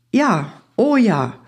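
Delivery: slow, high, delighted exclamation; storybook delight, lifted and stretched. A narrator's reading. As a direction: draw it out high with joy. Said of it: "freute sich der Mai".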